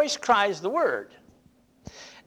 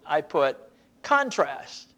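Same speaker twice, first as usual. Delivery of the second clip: ordinary talk, nothing marked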